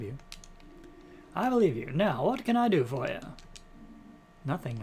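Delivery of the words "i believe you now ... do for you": put-on Mid-Atlantic accent. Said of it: "Maybe we'll do Mid-Atlantic accent".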